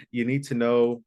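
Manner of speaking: ordinary delivery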